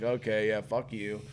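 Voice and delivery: retold brush-off, dropping in volume. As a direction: toss it back quietly, unimpressed. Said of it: "And I'm like".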